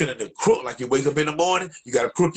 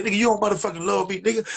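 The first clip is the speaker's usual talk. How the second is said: high, fast furious rant; angry rant; 8 semitones higher, faster than usual. Retold or imitated. Tree yelling at his friend on the phone, high angry rant. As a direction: tear into him, pitched up and quick. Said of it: "I said".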